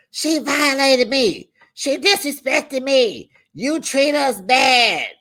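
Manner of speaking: hammed-up aggrieved mother complaining to her son; whiny mother impression. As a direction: play the wronged mother, dragging it out. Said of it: "So she's all just".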